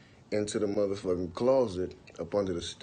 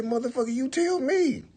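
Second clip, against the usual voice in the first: much higher, louder, fast angry yelling; furious; 8 semitones higher, 6 dB louder, faster than usual. Retold or imitated. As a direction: confront him hard. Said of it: "I say".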